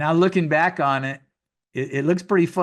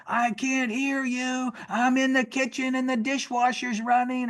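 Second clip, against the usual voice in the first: higher, hammed-up call from the kitchen; mock holler; 8 semitones higher than usual. Wife calling back from the kitchen over the dishwasher, mock holler. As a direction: imitate the wife calling up the stairs, pitched up. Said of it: "holler back up at me like".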